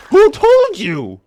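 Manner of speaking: high, loud outraged yell; outraged shout. Real, not acted. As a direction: shriek it in disbelief. Zombie sees him, outraged.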